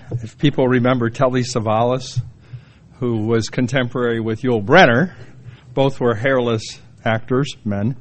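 ordinary talk, nothing marked

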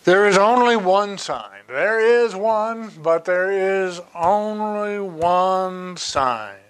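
high, slow, drawn-out proclamation; emphatic preaching. Real, not acted. Preacher insists on the one sign, high slow proclaiming. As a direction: proclaim it, stretching out each key word. Preacher hammers his central point.

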